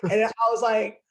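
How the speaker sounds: normal talking voice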